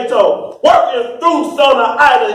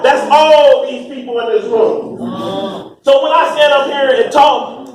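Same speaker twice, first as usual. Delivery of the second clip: raised, driving preacher's cadence; fiery preaching; 5 semitones higher than usual. Acted. Preacher to the congregation about Sona Ida, fiery preaching. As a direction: preach it full force to the room.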